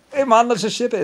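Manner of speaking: mock Irish accent, high and loud; hammed-up Irish lilt, carefree. Retelling the gang's lazy plan, in a mock Irish accent. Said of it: "With an Irish accent, sorry".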